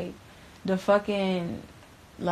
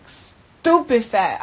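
usual speaking voice

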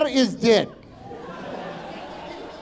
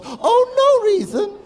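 put-on much higher, feigned-innocent Russian-accented reply; mock innocence; 10 semitones higher than usual. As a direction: brush off suspicion in a high, innocent tone. Feigned innocence after sinister question.

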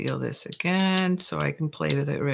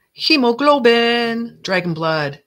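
loud, drawn-out potion proclamation; grand announcement; 6 semitones higher, 9 dB louder than usual. Pretend. Potion maker unveiling her bottle's name, grand announcement. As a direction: start high and loud, stretching HEMOGLOBIN, then drop in pitch.

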